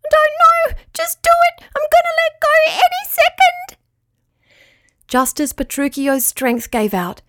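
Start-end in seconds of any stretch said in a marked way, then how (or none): 0.1-1.6 very high strained squeaky voice; tiny creature holding on with all its strength; high squeak under strain. Acted. Crayfish character voice. 1.7-3.8 very high breathless whispered squeak; tiny creature about to give up; strained whisper. Acted. Crayfish exhausted whisper.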